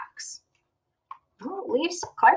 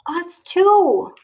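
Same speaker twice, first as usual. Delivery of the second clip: usual speaking voice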